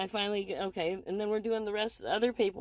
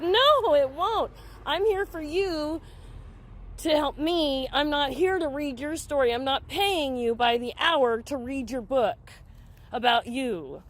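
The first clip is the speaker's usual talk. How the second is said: retold angry refusal; 3 semitones higher, 3 dB louder than usual. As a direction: replay putting your foot down. Retold quarrel with counselor.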